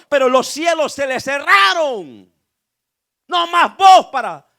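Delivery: loud preacher building to a shout; full-force pulpit crescendo; impassioned preaching. Real, not acted. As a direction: drive it harder with each phrase until you cry it out.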